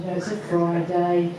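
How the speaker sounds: ordinary talk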